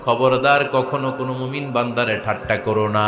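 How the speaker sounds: low, chant-like solemn rendering; intoned translation. Acted. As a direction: intone God's warning to the believers.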